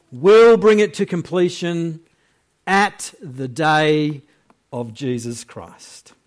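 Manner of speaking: loud, emphatic Bible reading; scripture reading. Acted. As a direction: proclaim the verses to the church.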